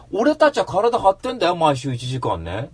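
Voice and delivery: venting at the journalist; angry shout. Real, not acted. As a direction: bark it at the writer. Angry rant about an article.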